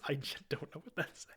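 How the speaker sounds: soft unvoiced murmur; whispered aside. Real, not acted. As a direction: mutter it to yourself, puzzled. Aside about script.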